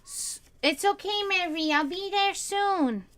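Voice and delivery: high, slow sing-song coo. Real, not acted. Owner promising her cat she is coming, slow high coo. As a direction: stretch it out sweetly, up high. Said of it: "That's the only way I can talk to my cats".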